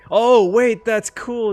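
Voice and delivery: a real burst of delight at something cool; sudden thrilled gasp of approval; excited. Real, not acted. Sudden delight at game screen.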